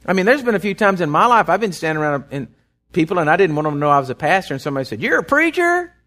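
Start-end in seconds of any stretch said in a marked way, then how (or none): 5.0-5.9 high, incredulous question; mock astonished stranger; surprised question. Stranger recognizing the pastor, high and astonished.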